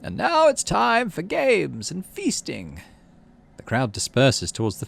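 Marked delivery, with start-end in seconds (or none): 0.0-2.8 higher, slow, grand announcement; announcing governor. Acted. Udicott opening the festival with a slow grand announcement. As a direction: proclaim the feast slowly and grandly.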